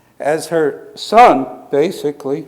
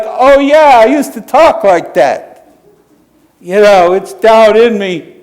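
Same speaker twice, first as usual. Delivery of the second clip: much higher, louder, bright admission; cheerful confession; 5 semitones higher, 7 dB louder than usual. Retold or imitated. Marcella telling the domino table she used to talk that way, loud and cheerful. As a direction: own it with a big laugh in the voice. Marcella admits her old ways.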